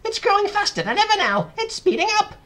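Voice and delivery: very high, loud excited shriek; Aunt Spiker shouting. A narrator's reading. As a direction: shout for attention in amazement. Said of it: "Aunt Spiker shouted".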